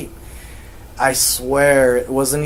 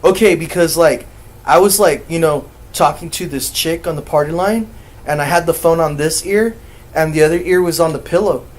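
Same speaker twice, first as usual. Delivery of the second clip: loud, higher, agitated explaining; imitating a worked-up nephew, voice raised; rattled nephew; 4 semitones higher, 6 dB louder than usual.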